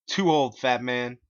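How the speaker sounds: high, loud bossy voice; hammed-up high gatekeeper voice; Clyde's voice. A narrator's reading. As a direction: lay down the rules like a bossy leader. Clyde judges Bob.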